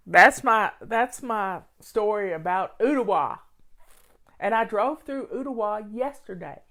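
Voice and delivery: usual speaking voice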